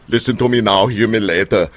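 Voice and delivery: put-on a light action-hero accent for a tough guy slogan; Arnold Schwarzenegger. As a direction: lightly mimic the muscleman star's accent. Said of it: "tweak it to sound like Arnold Schwarzenegger".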